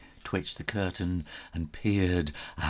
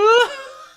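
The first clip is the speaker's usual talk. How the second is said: very high loud witchy cackle; high crazy laugh; 12 dB louder than usual. A narrator's reading. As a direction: cackle like a mad old aunt. Aunt's cackle.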